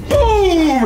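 loud burst of boom; loud shout. Real, not acted. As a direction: belt it out. Loud exclamation on waking.

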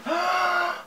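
high, soft gasp of delight; gasp, thrilled by what was just watched; excited gasp. Real, not acted. Excited reaction as video ends.

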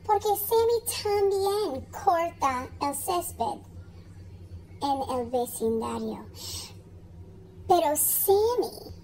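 ordinary delivery

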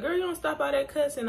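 mock a mother telling her off, fast; quick high mock scolding from mom; imitating mom. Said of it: "My mom gonna be like".

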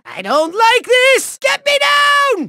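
high, alarmed villain wail; comic panic. Acted. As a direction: yelp to be let down.

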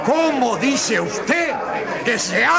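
high, slow stunned question; shocked disbelief. Acted. Official hearing the hospital collapsed, high stunned disbelief. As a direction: reel at the news.

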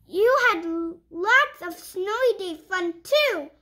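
lively picture-book read-aloud; reading to children. Acted. Reading a puppy picture book to young viewers, bright storytime voice. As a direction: read it like storytime for little kids.

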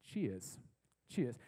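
put-on low, amateur British accent. Traveler passing people on the walkway, in a faked British accent. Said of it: "I'm saying in my British accent".

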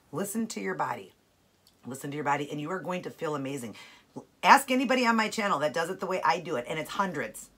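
ordinary talk

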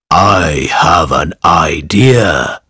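low, slow wolf voice; lightly voiced sly wolf. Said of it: "said the wolf".

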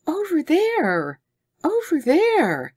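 high, slow urgent cry; insist, pointing in alarm; insistent alarm. A narrator's reading. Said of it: "her arm extended, persisted".

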